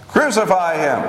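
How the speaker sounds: mild narrated outcry, pitched high. A narrator's reading. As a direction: raise the pitch as the crowd insists. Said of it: "They cried out all the louder".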